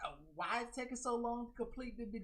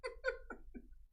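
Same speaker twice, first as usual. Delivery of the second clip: airy laugh; 6 dB quieter than usual. Real, not acted. Laughing at own bit.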